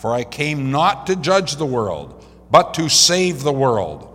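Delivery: measured, emphatic pulpit reading; scripture reading. Acted. Preacher reads Jesus' words to the congregation, emphatic scripture reading. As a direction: read it out firmly to a congregation.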